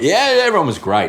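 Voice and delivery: high-pitched, enthusiastic yeah; upbeat retold cheer. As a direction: open on a big high cheer. Retold upbeat first-day reaction.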